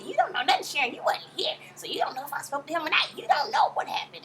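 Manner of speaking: high, loud acted scolding; mock quarrel, high-pitched and forceful; indignant retort. Acted quarrel, Mama Joyce snapping at Sharon.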